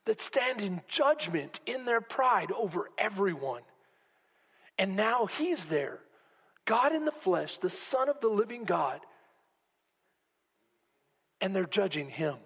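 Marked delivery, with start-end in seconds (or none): none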